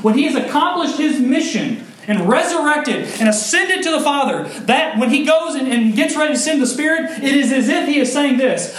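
high, loud impassioned sermon; soaring preaching. Acted. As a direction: lift it up to heaven. Preaching at full force.

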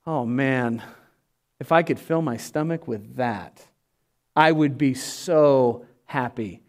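low, soft, longing sigh of a hungry son; dreamy and hungry, voice dropped low; mild hammed-up longing; wistful prodigal son. Prodigal son longing at pig slop.

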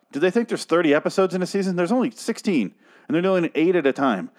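high, incredulous complaint; exasperated rant. Real, not acted. Reviewer fed up with the show's pace, exasperated.

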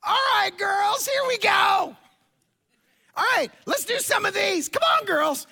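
hammed-up motivational yell, very high and strained; rally the girls at the top of your voice; high, over-the-top coach bellowing; megaphone pep-talk shout. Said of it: "I have this megaphone".

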